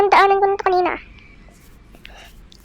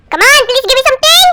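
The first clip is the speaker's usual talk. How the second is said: pleading; 6 semitones higher, 4 dB louder than usual. Real, not acted. Begging the game for a skull.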